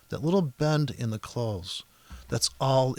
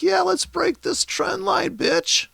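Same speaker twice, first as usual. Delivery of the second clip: much higher, louder, slow taunt; forcing a trade; 5 dB louder, slower than usual. Retold or imitated. Trader voicing himself forcing a trade, high loud slow taunt. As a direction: egg the chart on, high and loud, drawn out. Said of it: "I'm like".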